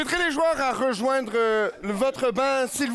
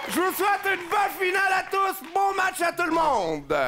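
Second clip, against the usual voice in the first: higher, shouted crowd hype; 8 semitones higher than usual. Real, not acted. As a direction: fire up the audience. Referee hyping the crowd.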